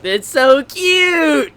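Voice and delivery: high, drawn-out squeal on "cute"; gush, stretching the words upward. Real, not acted. Fan looking at pictures in the book, gushing squeal.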